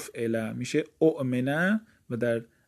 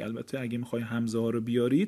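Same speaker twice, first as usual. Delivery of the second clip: ordinary talk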